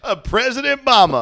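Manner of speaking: high, loud hammed-up announcement; mock Italian. Friends crowning Mama president, mock Italian. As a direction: proclaim it like a campaign slogan. Joking about Italian election.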